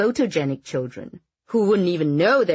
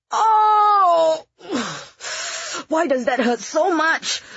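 sudden cry of pain, high and loud; pained groan; 13 semitones higher, 4 dB louder, much slower than usual. Acted. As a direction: yelp and groan as the foot is pressed. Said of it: "suddenly groaned".

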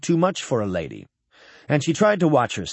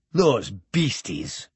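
low, slow, understated contempt; spat out; 5 semitones lower, 3 dB quieter, slower than usual. A narrator's reading. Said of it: "he spat".

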